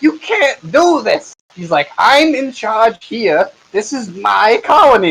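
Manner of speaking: high, slow, indignant official; pompous senator oratory. Acted. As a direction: protest like an outraged nobleman. Pompous magistrate protesting.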